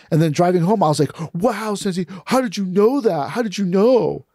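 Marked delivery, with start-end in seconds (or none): 1.3-4.2 higher, wide-eyed amazement; awed admiration. Retold or imitated. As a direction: marvel at him like a starstruck student. Awed questions on drive home.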